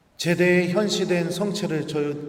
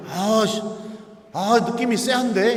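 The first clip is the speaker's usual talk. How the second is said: louder repeated uneasy remark; 6 semitones higher, 4 dB louder than usual. Retold or imitated. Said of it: "이러는 거예요".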